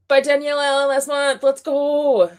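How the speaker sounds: high, loud fangirl hype; excited high call, held up to the end; hyped cheer. Real, not acted. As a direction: cheer for your favourite author. Cheering for a favourite author.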